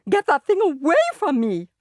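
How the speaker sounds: very high, slow, loud shriek; shrieking lady. A narrator's reading. Lady shrieks at the turtle held under her nose, very high. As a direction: shriek in horror at the reptile. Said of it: "Missus Yarby shrieked".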